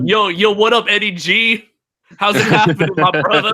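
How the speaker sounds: high, loud hammed-up homeboy voice; street talk. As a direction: play the cool street dude. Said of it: "You're like".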